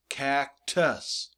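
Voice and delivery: cactus broken as CAC... TUS; syllable by syllable. Shown as a demonstration. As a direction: separate cactus into two distinct beats. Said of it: "the green part is the second syllable".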